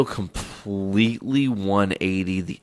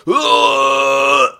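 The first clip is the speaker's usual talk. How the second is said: loud strained heaving grunt; imitated retching; 7 semitones higher, 11 dB louder than usual. Retelling vomiting at friend's house, loud imitated heave. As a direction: retch it out hard. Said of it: "violently projectile vomiting".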